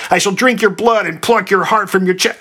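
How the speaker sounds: high-pitched theatrical threat; hammed-up villain's vow; mild shriek. A narrator's reading. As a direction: keep shrieking grandly, pitch held high. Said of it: "shrieked the Sphinx".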